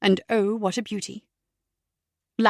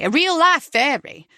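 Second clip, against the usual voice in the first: higher, louder wide-eyed wonder; excited; 5 semitones higher, 5 dB louder than usual. Acted. Comedian building the fairy joke, higher and louder. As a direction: sell the magic of it.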